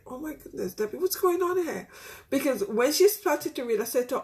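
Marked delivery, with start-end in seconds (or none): 0.1-1.9 retold inner surprise, pitch climbing; taken aback. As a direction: wonder at yourself, taken aback. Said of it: "taken aback and thinking".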